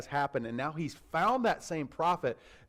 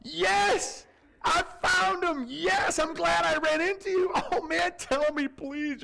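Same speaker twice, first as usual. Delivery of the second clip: much higher, loud, joyful shouts; overjoyed Obadiah; 12 semitones higher, 3 dB louder than usual. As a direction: cheer as if he finally found him. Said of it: "You would think Obadiah would be like".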